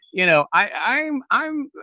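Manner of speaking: normal talking voice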